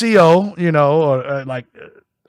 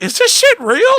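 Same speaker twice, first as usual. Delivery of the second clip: much higher incredulous question; disbelief; 4 dB louder than usual. Retold or imitated. A kid on the jail tour asking in disbelief, high incredulous squeak. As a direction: squeak it out in shock.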